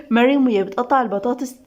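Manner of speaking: plain reading voice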